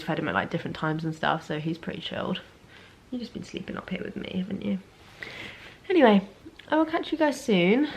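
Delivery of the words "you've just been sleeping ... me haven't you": hushed, half-whispered to the dog beside her; gently whispering to the dog in bed; soft words to the dog. Real, not acted. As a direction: murmur to the sleepy dog. Talking softly to her dog.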